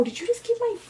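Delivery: high, shocked; shocked outrage at the hurt; indignant. Real, not acted. Indignant after being hurt.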